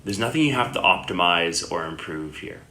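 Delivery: unhurried meditation guidance with long pauses; guided meditation. Acted. Yoga teacher guiding listeners lying down into Yoga Nidra, calm meditation voice. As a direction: settle the listener gently, leaving long silences between phrases.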